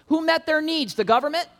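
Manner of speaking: preacher climbing to a loud peak; building rhetorical questions. Real, not acted. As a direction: push the crowd toward the answer.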